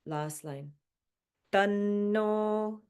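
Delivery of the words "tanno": Tanno with the N held long; held double N. Shown as a demonstration. Teacher shows the long double N in Tanno. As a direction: hold the N in Tanno before the o. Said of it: "The double N means that you're really, like, emphasizing the N sound".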